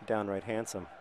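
usual speaking voice

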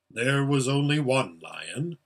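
slow, firm, weighty statement; solemn voice of a great being; large voice. A narrator's reading. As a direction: say it slowly with grave authority. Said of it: "said the voice".

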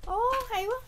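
giọng vút cao vì thích; thích thú reo lên. Real, not acted. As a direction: reo lên khen hay. Khen cái khay. (ngạc nhiên khen)

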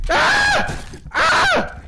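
very high, loud shrieks; piercing squeals of panic; terrified screams. Real, not acted. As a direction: scream as you get killed in the game. Dying in the game.

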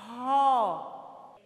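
"Ró" loud, with a strong guttural r; strong r sound. Shown as a demonstration. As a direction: push out "Ró" with a heavy throaty r. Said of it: "eles têm um som mais forte". ("Ró" alto, com o r forte)

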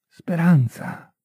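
speranza drawn out slow and falling; lingering speranza. A narrator's reading. Narrator closes the morning scene, speranza said slowly. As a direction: stretch speranza out slowly, like a breath. Final repeat of hope.

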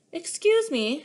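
put-on sweet and bright old lady. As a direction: chirp it brightly. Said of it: "she said brightly".

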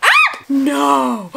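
real startled shout; 1 surprised scream. Real, not acted. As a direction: squeal at the unboxing. Reacting to surprise package.

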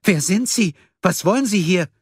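high-pitched, alarmed demand; startled nurse. A narrator's reading. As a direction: challenge an intruder in a high, frightened voice. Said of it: "Sie musterte ihn erschrocken". (erschrockene Pflegerin, hohe Stimme)

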